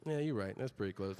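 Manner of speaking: low, unimpressed agreement; dry sarcasm. Retold or imitated. DMV clerk humouring a failed patient, dry sarcasm. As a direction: agree drily, dropping low at the end. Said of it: "like that".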